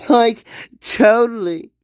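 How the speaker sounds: lightly voiced valley girl, totally; a hint of valley girl. Teasing his sister with a light valley girl voice. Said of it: "She's a valley girl".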